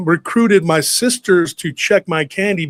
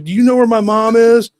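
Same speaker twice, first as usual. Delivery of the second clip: high, loud frantic plea; losing his mind; 8 semitones higher, 6 dB louder than usual. Retold or imitated. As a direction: imitate a panicking kid on the phone. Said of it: "I was freaking out, dude. I was losing my mind".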